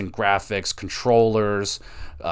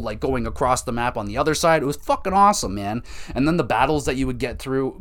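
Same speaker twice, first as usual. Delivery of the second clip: normal talking voice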